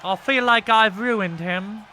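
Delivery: put-on slow, drawn-out British accent with a cute tang. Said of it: "Every time I do a British accent".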